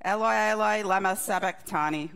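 ordinary talk

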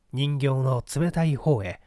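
usual speaking voice

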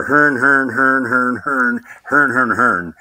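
loud repeated "hern" to find American; American accent warm-up. Shown as a demonstration. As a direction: drill an American R sound, loudly. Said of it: "how I get the American one".

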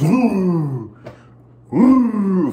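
high, loud hammed-up whooping; mock whoop. Flipping off the cliff with cousins, loud high whoop. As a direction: howl like a bird leaving the nest. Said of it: "we just on them like".

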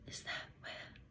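breathless hushed whisper. A narrator's reading. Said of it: "whispered Professor McGonagall".

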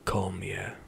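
ordinary talk

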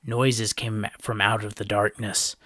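ordinary delivery, nothing marked

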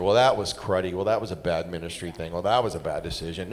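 low, downbeat mock complaint; acted grumbling. Voicing a complaining thermometer person.

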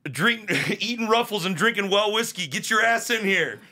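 high, loud roast from the stage; mock stage call-out. Roasting the crowd from stage, loud mock call-out. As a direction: bark it into the mic. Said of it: "I was like".

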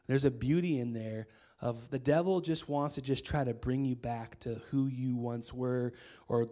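normal talking voice

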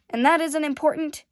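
put-on a boy close to bursting into sobs; impassioned challenge. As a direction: throw it at him one last time. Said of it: "His face turned red now".

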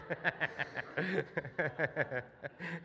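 real laugh. Real, not acted. Speaker cracks up at mixing up Garrett with Jesus, real laugh. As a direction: burst of laughter at own slip.

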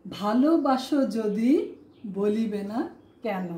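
ভালোবাসো যদি read out slowly and drawn; unhurried line reading, each word drawn long. Shown as a demonstration. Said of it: "লাইনটা আরেকবার দেখি".

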